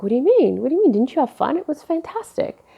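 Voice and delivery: baffled and a bit wounded; surprised protest. Retold or imitated. Asking her boyfriend why he barely survived the party, high and incredulous. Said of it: "I was like".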